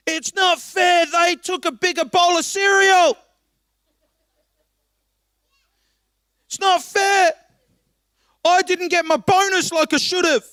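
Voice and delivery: high, loud, whining shout; hammed-up sulky cry of unfairness; whiny petty complaint. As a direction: throw a childish tantrum. Mocking petty complaint, acted.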